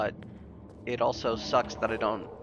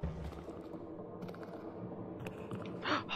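startled gasp; 16 dB quieter than usual. Real, not acted. Sudden scare in game.

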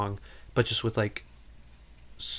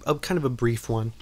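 normal talking voice

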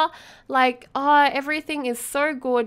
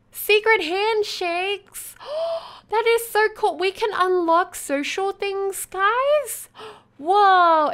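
1 thrilled; 6 semitones higher than usual. Real, not acted. Thrilled by new club perks.

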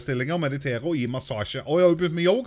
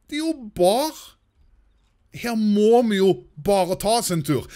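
much higher, amazed discovery; surprised; 7 semitones higher than usual. Real, not acted. Gamer amazed to find a bar in the city. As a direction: gasp at the find.

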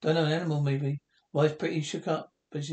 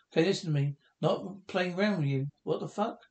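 ordinary talk

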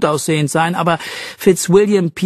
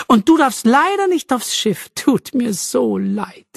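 mock pity; 8 semitones higher than usual. Taunting Jack, mock sympathy.

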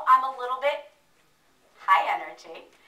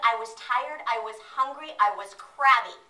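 usual speaking voice

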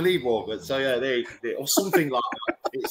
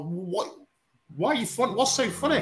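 retold baffled challenge; 5 semitones higher than usual. Guest retelling his reaction to laughing emojis, mock baffled.

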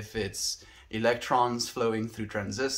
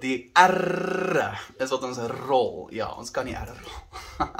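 put-on Afrikaans with a South African accent; rolled R in South African accent; 4 dB louder than usual. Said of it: "with the South African accent".

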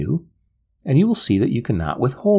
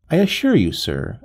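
plain reading voice

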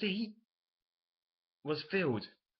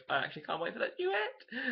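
higher, giddy squeal of anticipation; excited gushing; 8 semitones higher than usual. Real, not acted. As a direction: gush like a thrilled fan. Gushing over upcoming duet.

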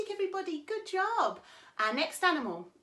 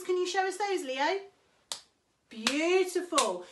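ordinary talk

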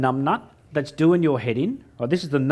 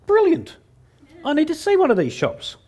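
much higher, bursting with a brainwave; mock excitement; 11 semitones higher than usual. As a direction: sell the eureka moment. Retelling his own excited idea.

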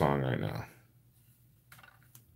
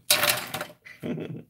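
breathy laugh. Real, not acted. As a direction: laugh at her own French. Laugh after French attempt.

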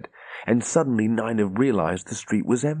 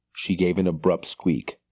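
ordinary delivery

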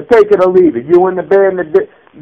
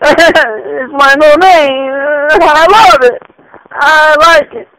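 delighted; 8 semitones higher, slower than usual. Acted. Doyle delighted by new name.

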